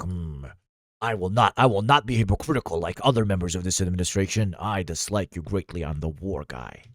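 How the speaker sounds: low, soft, grave impression; Hegseth, very serious. War guy stiffly declaring his dislike, mock-serious low voice. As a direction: drop the voice into solemn self-importance. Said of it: "Hegseth was very serious. He was like".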